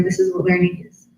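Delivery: usual speaking voice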